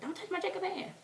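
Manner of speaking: high-pitched mock warning; high, possessive fangirl whine; protective fan. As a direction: squeal it, guarding your precious hand. Acting out guarding the touched hand.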